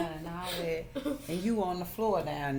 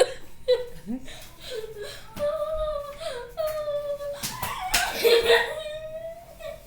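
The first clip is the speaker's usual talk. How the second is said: high squealing giggle; shrieking laugh. Real, not acted. Daughter laughing on floor.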